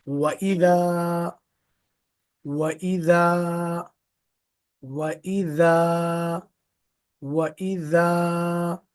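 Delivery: वइदा read very slowly with the long आ held; stretched long vowel. Shown as a demonstration. Teacher repeating the word for students, long vowel drawn out. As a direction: hold the आ in वइदा. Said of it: "ये हमारा long vowel है".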